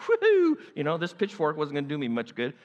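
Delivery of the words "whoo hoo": high, loud relieved cheer; gleeful whoop. Acted. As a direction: whoop like men freed to go home. Men gleefully going home.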